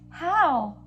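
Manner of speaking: eager high questions; curious kid asking. Pretend. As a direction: ask like a curious child. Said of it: "Do you ever say".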